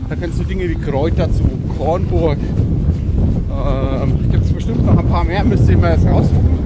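wind on mic. Real, not acted. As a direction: wind noise over voice. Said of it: "Sorry für den Wind".

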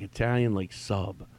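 ordinary talk

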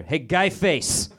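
hammed-up Boston accent; mock Boston accent. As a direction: greet him like a swaggering Boston local. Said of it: "that's how they talk out there".

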